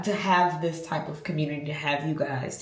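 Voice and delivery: normal talking voice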